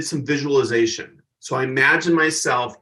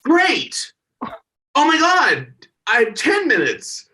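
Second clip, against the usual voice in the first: much higher, bubbling excitement; thrilled; 11 semitones higher than usual. Retold or imitated. The opener hearing he gets ten minutes, high and thrilled. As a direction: gush over the extra time. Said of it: "And I'm like".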